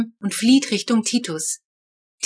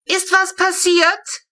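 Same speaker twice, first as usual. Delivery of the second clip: high, slow call from a distance; a neighbour woman calling down, concerned; neighbour calling from balcony; 11 semitones higher, 4 dB louder, slower than usual. A narrator's reading. Frau Meitner calling from her balcony to the garden, high and slow. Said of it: "Frau Meitner von ihrem Balkon zu uns rüberrufen".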